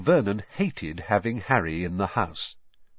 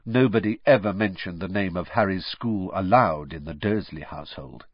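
ordinary delivery, nothing marked

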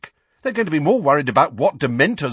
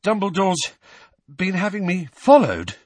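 higher-pitched, halting question; panting; 10 semitones higher, 5 dB louder than usual. A narrator's reading. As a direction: ask it in pieces while out of breath. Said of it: "Harry panted".

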